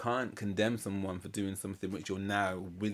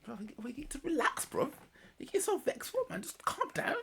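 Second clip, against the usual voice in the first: very high squeaky mimicry of his bro; mock the friend's soothing in a tiny high voice; imitating best friend. Imitating his best friend's pleading advice, high squeaky. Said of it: "he'd always say to me".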